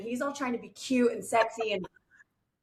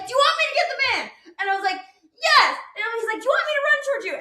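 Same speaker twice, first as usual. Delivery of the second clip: eager husband; 13 semitones higher, 5 dB louder, much faster than usual. Retold or imitated. Husband's eager offers quoted high.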